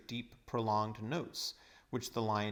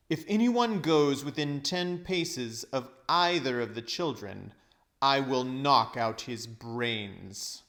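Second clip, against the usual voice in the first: loud, slow threat; menacing witch; 7 dB louder, slower than usual. A narrator's reading. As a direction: menace every word, slowly. Witch threatens them all.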